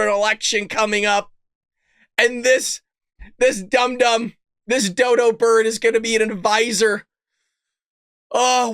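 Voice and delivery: usual speaking voice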